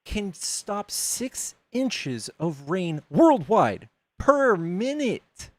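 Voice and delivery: very slow, word by word; drawn-out, deliberate rhetorical question; slow emphasis. Real, not acted. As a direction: spell out the absurdity, dragging each word. Driving home the flood math.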